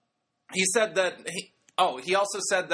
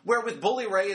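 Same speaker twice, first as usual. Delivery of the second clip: usual speaking voice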